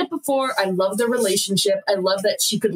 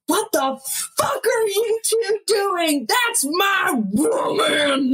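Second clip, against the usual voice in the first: high, drawn-out outraged yell; mock furious outburst; 10 semitones higher, slower than usual. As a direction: act out losing it, stretching each word. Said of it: "He's so fucking mad".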